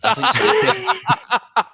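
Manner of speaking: very slow, laughing through it. Real, not acted. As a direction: drag it out while cracking up. Laughing at the hate crime joke.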